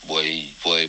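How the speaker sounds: normal talking voice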